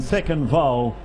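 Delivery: usual speaking voice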